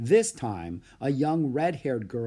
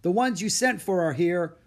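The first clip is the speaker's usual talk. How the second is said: raised, higher voice for someone hard of hearing; shouting; 6 semitones higher, 5 dB louder than usual. A narrator's reading. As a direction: shout it so the old man hears. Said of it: "the cook shouted, as if the man were hard of hearing".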